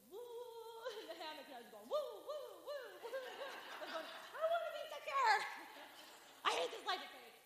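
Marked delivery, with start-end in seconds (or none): 0.1-3.5 high, soft wobbly woo sounds; wobbling woo. Retold or imitated. 4.3-7.4 high panicked pleading; panicky plea. Retold or imitated.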